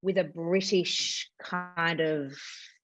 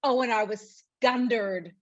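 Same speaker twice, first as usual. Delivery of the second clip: Northern Irish quote. Retold or imitated. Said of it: "they ever say".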